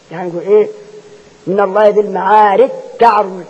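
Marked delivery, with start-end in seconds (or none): 1.5-3.4 recited من الله ذي المعارج تعرج with the جيم merged into the تاء; jim merged into ta. Shown as a demonstration. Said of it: "لا بد الجيم تدغم تاء".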